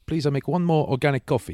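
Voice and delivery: high-pitched imitation of a Romanian builder; Romanian accent. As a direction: ask politely in a put-on Romanian accent. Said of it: "is that a Romanian accent".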